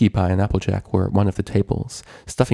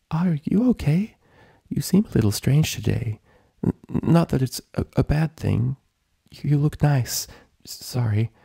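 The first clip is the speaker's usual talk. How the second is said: Fluttershy timid; 7 semitones higher than usual. A narrator's reading. Fluttershy asking Rainbow if she is okay, timid. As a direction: worry timidly, tripping over words.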